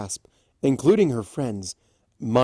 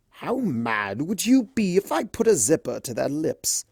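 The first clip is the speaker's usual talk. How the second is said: higher, playful scheming; mischievous offer; 5 semitones higher than usual. A narrator's reading. Discord offering Fluttershy a prank, mischievous. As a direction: tempt her playfully. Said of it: "Discord asked".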